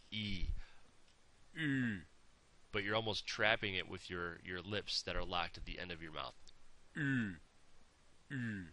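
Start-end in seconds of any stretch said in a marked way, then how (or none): 1.6-2.0 U held long, lips locked tight; exaggerated German Ü; overemphasized Ü. Shown as a demonstration. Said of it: "I'm overemphasizing it". 6.9-8.6 U held long, lips locked tight; exaggerated German Ü; overemphasized Ü. Shown as a demonstration. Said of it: "I'm overemphasizing it".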